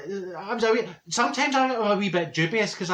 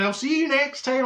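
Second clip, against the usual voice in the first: mock psychic farewell; 9 semitones higher than usual. Psychic leaving, waving.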